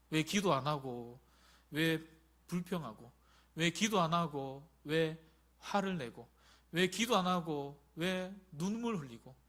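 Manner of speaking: slow repeated chiding questions; slow rebuke. Real, not acted. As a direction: press each why slowly. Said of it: "repeated preaching rebuke".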